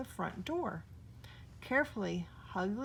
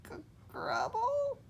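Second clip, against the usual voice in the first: scared whisper. A narrator's reading. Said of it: "he whispered".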